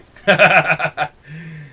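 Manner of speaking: a loud burst of real laughter; big amused laugh; hearty laugh. Real, not acted. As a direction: laugh out loud at your own joke. Laughing at own rebel boast.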